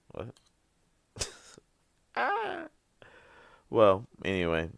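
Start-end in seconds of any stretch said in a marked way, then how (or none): 2.2-2.7 high crying laugh sound; laughing wail. Real, not acted. Laughing cry after joke.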